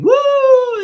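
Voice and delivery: high, loud joyful whoop; squealing fan cheer; excited whoop. Retold or imitated. Girlfriend cheering at celebrity.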